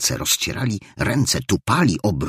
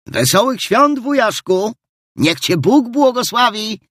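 put-on much higher, young and ringing greeting; young ringing nephew; 5 dB louder than usual. Nephew bursting in greets his uncle, young and ringing. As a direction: call it out young and ringing, full of cheer. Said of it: "Ozwał się nagle głos młody i dźwięczny".

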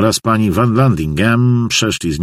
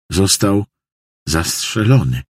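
halting, broken in two by a pause; jąkanie się. A narrator's reading. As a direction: stammer it out haltingly. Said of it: "wyjąkał Hop". (lekko wyjąkane, urywane)

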